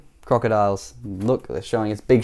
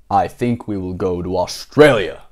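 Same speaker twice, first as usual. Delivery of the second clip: lower, grown-up storybook voice; Mister Daydream; 6 semitones lower than usual. Acted. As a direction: drop into his lower voice.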